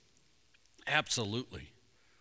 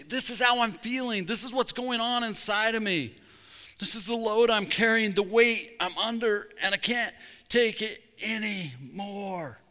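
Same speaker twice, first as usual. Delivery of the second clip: high, pleading cry to God; acted overwhelmed prayer; burdened lament; 7 semitones higher, 3 dB louder than usual.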